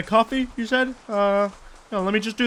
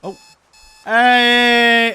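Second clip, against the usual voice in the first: startled shout; 4 semitones higher, 8 dB louder than usual. Real, not acted. Sudden startled call.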